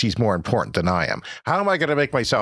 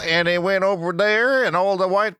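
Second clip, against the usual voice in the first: put-on Georgia accent; 6 semitones higher than usual. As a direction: play a country kid from Georgia in a hammed-up Southern accent. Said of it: "This is a Georgia accent".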